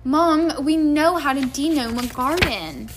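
loud, whiny protest held high; groaning. A narrator's reading. George protesting the gnome chore to his mother, groaning. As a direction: complain to mum in a drawn groan. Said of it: "George groaned".

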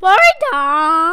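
loud drawn-out Welsh greeting; playful shout. Pretend. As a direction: belt it out, stretching the last vowel.

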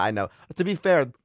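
usual speaking voice

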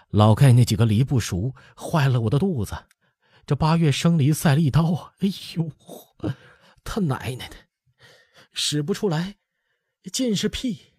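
breathless groans, rising high; groaning, out of breath. Acted. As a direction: gasp between pained words. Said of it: "前气不接后气说". (上气不接下气地呻吟)

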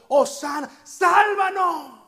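slow desperate yell for rescue; desperate cry. Acted. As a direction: cry out Hosana, sálvanos as if your life depended on it. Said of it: "Es como un grito desesperado".

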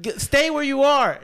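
high, loud retold command; urgent shouted order. Friend on phone to lost drunk, urgent shout. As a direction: call it out to stop him wandering.

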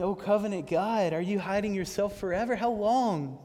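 impassioned lament. Acted. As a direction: preach it like a desperate cry to God. Preacher voicing the psalmist's lament.

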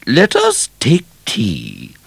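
high, slow regal greeting; stately royal welcome; Queen Victoria. A narrator's reading. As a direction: greet him like a gracious queen. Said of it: "said the Queen".

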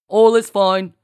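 loud, booming fake captain; put-on captain voice. A narrator's reading. Marcy bluffs harder through the door, as captain. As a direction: put on a big commanding captain voice. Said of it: "deepened her voice even more".

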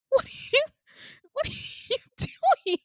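very high, loud broken squeal; squealed disbelief. Retold or imitated. Patient to nurse climbing into her bed, squealed disbelief. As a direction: squeak it out in mock outrage, word by word.

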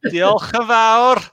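big, slow Welsh thank-you; Welsh accent. Shown as a demonstration. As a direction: lay on the Welsh accent proudly. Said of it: "that's the best accent ever".

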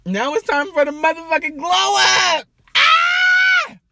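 big, high, loud celebratory yell; hype shout. Real, not acted. To her viewers, celebrating her new job: high, loud hype shout. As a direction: scream it out like you just won.

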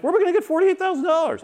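husband quotes his panicking wife; imitating freaked-out wife. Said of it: "my wife got a little freaked out".

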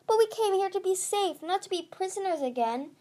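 high, squeaky protest; frightened cry. A narrator's reading. Young dragon protests being imprisoned again, distressed and high. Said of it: "She squeaked in terror".

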